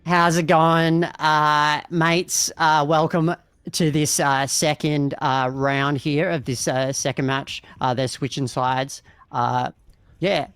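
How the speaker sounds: lightly put-on Aussie tradie voice; Aussie bloke accent, laid back. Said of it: "we're now Aussie tradies for the next round".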